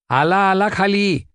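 calling out. A narrator's reading. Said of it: "ओरडून सांगितलं".

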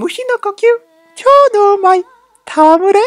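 very high falsetto anime voice; anime attack call. Acted. Fan acting out her poison technique, high-pitched attack call.

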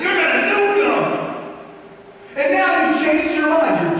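loud protest climbing to a high-pitched rant; upset, angry Jonah. Acted. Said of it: "Jonah was really upset and angry".

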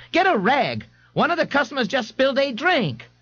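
very high-pitched mother character voice; calling out. A narrator's reading. A mother asks the bartender for a rag, calling out. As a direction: call for help in a high voice. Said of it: "Missus Riley called".

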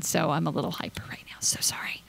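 whisper, no voice, a little fast. Real, not acted. Vlogger whispering that she's hyper from soda. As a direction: confide it under your breath.

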